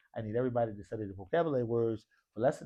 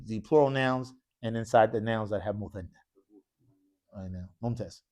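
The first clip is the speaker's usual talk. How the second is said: normal talking voice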